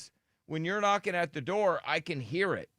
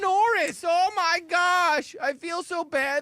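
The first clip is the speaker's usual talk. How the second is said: mock sympathetic listeners; 12 semitones higher, 5 dB louder than usual. Host mimicking listeners who pity his co-host, high overwrought wail. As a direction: gush with exaggerated mock concern. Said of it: "Everybody's been like".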